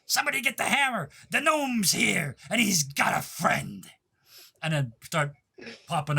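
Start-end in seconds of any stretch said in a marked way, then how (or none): 0.0-3.9 high yell, slowing as it goes; screamed taunt. Acted. Gnome popping up from the holes, screaming to the staff. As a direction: start high and fast, then draw it out. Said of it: "pops up and screams".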